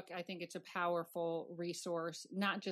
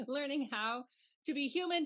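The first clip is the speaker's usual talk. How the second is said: laughing; 8 semitones higher than usual. Real, not acted. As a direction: let the laugh spill into the words. Host laughs again.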